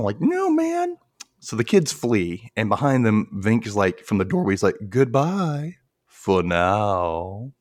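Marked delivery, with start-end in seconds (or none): 0.2-1.0 very high mock whine; mock frightened protest. Co-host joins the scared kid bit, mock panic. 4.9-7.5 slow, drawn-out villain farewell; Vink. Retold or imitated. Vink calls from the doorway, ominously drawn out.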